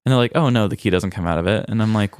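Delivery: ordinary delivery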